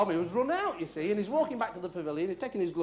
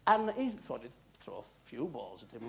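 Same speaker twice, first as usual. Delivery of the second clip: ordinary talk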